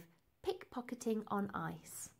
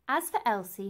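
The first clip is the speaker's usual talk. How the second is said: ordinary delivery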